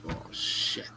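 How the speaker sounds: breathless whisper under the breath; hushed curse on spotting the damage; whispered oh shit. Retold or imitated. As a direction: whisper it to yourself in dismay. Sees damage on her car.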